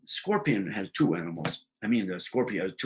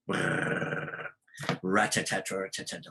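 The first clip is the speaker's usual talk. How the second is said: imitated chatter; 4 semitones lower, 5 dB quieter, slower than usual.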